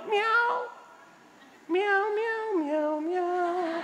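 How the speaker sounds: high-pitched drawn-out meows; cat imitation. Gloria hiding in a tree from ambushers, meowing like a cat. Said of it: "Anong sabi ni Gloria?".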